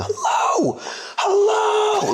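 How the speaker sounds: imitated night-terror scream, very high pitch; panicked screaming. Bandmate imitates tech screaming from his bunk, panicked screaming. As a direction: scream out like someone waking in panic. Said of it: "started screaming".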